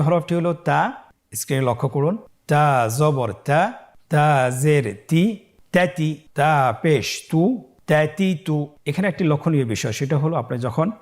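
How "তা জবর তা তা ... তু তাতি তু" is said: drawn-out syllables in an even chant; stretched, measured letter-by-letter recitation; slow spelling drill. Shown as a demonstration. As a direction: lead learners through each sound, very slowly.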